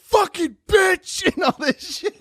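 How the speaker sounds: high, slow, screaming rage at a heckler; furious heckle shout. Retold or imitated. Comic retelling a comedian screaming at a heckler, enraged. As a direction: scream it down like you snapped. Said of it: "he just immediately goes".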